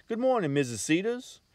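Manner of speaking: high, bright cheerful greeting. A narrator's reading. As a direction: chirp it at the door. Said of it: "he said in a cheerful voice".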